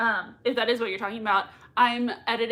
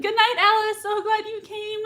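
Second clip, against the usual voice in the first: much higher, louder farewell; bright goodbye; 10 semitones higher, 4 dB louder than usual. Real, not acted. Host bids a viewer goodnight, excited. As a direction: sing out a happy goodnight.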